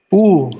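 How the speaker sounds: a loud, long oo like double O; loud teacher's oo; Russian oo. Shown as a demonstration. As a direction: say oo loud and round. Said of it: "this sounds like".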